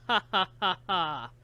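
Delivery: villain's cold ha ha ha; a short cruel cackle; the killer laughing coldly over the girl; cold harsh laugh. Acted. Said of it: "bursts out in cold and harsh laughter".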